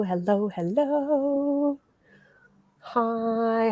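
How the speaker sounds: slow, drawn-out playful hellos; sing-song hello. Acted. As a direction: stretch the hellos like a cheery host. Greeting live viewers.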